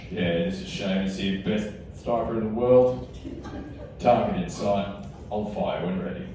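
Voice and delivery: low, clipped-down soldier voice; mock sniper radio. Comic pretending to radio as sniper, low mock soldier voice. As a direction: play a deadpan military sniper. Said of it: "Come in, Seamus".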